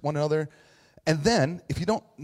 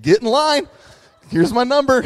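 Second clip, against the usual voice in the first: high, loud comic retort; mock shouting; 11 semitones higher, 11 dB louder than usual. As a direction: yell it back in playful defiance. Jokey retort to heretic accusation.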